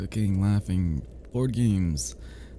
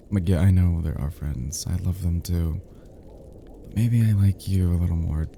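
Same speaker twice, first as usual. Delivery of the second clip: usual speaking voice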